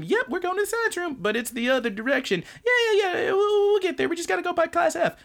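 very high, strained whiny squeal; very high flustered girl covering up; nervous anime girl. Retold or imitated. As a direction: whine it out, caught and flustered. Karen covering her stalking.

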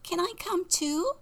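very high chick voice; high-pitched pleading chick; little chick. Acted. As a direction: squeak the request like a tiny chick. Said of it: "Jack met a chick".

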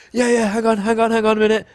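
high, put-on urgency; acted high-pitched stalling; mock hurrying. Pretending to run on phone.

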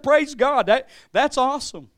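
loud, high burst of praise; retold elation. Son answering mom's news, retold loud jubilant praise. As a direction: re-enact a jubilant shout of thanks. Preacher retells his elated reply.

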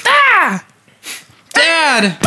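very high, drawn-out yelp; mock death cry. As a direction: cry out as if speared, in play. Acting out the movie's spearing.

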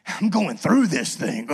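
loud, slow, hammed-up cry of resolve; mock determined yell. Father joking about breaking through for his child, hammed-up determined yell. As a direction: growl it out like nothing will stop you. Imagined charging through door.

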